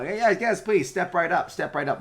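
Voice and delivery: high loud barker call; carnival barker. Acted. Mechanical barkers calling out.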